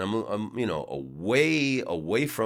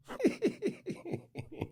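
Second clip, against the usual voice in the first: high, soft, breath-driven laugh; wheezy chuckle; 8 dB quieter than usual. Real, not acted. Comedian amused by his own Dutch insult, soft wheezy chuckle. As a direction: laugh lightly at your own punchline.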